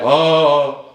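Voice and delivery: loud, long, swelling "Ah"; grandly sustained open "Ah"; old oratorical vowel. Shown as a demonstration. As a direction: project "Ah" like an old-school orator. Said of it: "insistait beaucoup sur les voyelles".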